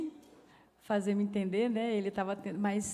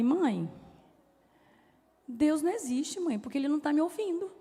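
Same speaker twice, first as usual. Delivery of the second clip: put-on higher, pleading voice of an upset teenage son; distressed son; 7 semitones higher than usual. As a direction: let the despair rise as the son cries out. Son's anguished complaint retold.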